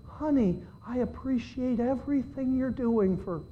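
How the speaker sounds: soft, calm, sweet reply. Retold or imitated. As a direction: soothe the wife gently. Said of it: "I calmly said to her".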